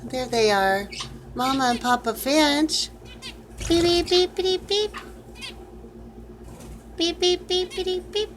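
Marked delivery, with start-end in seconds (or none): 3.7-8.3 high, light beeping like a little bird; bird chirp imitation. Owner chirping at her finches, high beeping bird calls.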